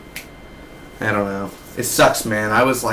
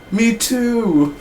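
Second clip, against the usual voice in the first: put-on much higher squeaky girl voice; mock squeaky reply; 11 semitones higher than usual. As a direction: chirp it back. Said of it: "he's like".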